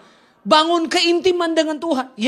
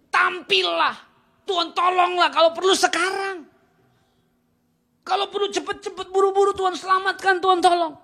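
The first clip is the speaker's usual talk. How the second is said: urgent cry for help; 6 semitones higher than usual. Retold or imitated. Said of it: "ini kan teriakan".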